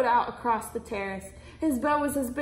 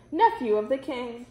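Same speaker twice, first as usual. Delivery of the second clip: ordinary delivery, nothing marked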